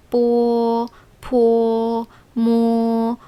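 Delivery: 뽀 포 모 each crisp, vowel 오 attached; Chinese initials drill. Shown as a demonstration. Teacher modeling pinyin initials for learners. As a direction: say 뽀, 포, 모 as clear syllables. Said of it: "포라고 발음해요".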